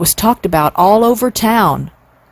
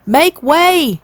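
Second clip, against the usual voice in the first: shouted; 8 semitones higher than usual. A narrator's reading. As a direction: clear the way with a shout. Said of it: "shouted the owner".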